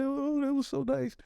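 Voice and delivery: very high, touched gush; gushing teachers. Retold or imitated.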